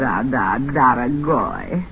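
low, slow Russian purr; Russian accent, drawn out and low; seductive fake Russian. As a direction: lure him in, Russian style. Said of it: "What happened to your Russian accent?".

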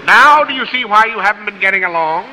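ordinary talk, nothing marked

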